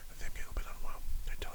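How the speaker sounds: soft unvoiced confession; whisper. Real, not acted. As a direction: whisper it like a secret aside. Secret aside about being unwell.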